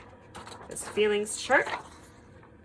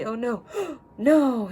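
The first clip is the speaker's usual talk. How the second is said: mock worried; 6 semitones higher, 8 dB louder, slower than usual. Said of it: "worried face or scared face".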